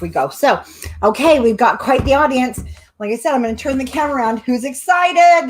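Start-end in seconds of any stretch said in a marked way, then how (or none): 4.4-5.5 higher, louder, slow hype to the crowd; excited call out. Real, not acted. Host hyping the live audience, drawn-out excited call. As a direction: pump up the crowd.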